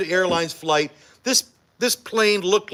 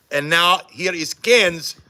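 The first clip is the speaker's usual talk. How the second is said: normal talking voice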